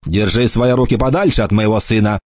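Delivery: ordinary delivery, nothing marked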